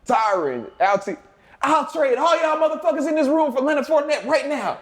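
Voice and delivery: fed-up coach impression, pitch rising to a peak; imitating exasperated coach. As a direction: list the players, then climb into a high-pitched outburst. Acting coach's rant to players.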